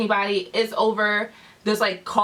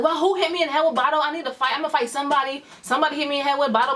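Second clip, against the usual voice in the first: fast, run-together retold rant; worked-up outrage; 3 semitones higher, much faster than usual. Alyssa wants to fight whoever hit her, worked-up. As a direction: imitate someone riled up for a fight. Hurt friend demands a fight.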